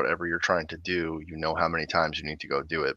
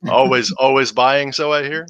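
teasing; 9 semitones higher, 13 dB louder, slower than usual. Real, not acted. Teasing the guest.

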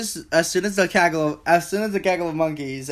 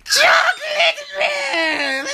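high, slow shrieking war cry; battle cry; much slower than usual. Acted. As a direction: scream it as a wild charge. Battle cry of scrawny boy.

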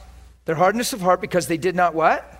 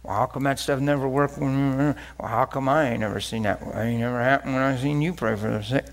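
doubting inner voice; 6 semitones lower, 4 dB quieter than usual. Retold or imitated. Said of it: "our minds are programmed to go".

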